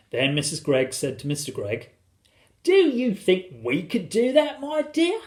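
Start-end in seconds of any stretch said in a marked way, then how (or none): 2.6-5.2 high, slow wondering question; high-pitched storybook wife; wife's voice. A narrator's reading. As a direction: ask it sweetly in a high voice. Wife's voice asking husband.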